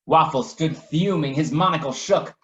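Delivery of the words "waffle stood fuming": ordinary delivery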